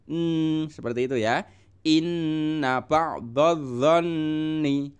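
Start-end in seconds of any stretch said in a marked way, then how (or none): 1.8-4.9 inna ba'dadh-dhanni with the nn pressed and held, slow; teacher demonstrating tajwid slowly; stressed doubled nn. Shown as a demonstration. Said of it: "harus ditekan".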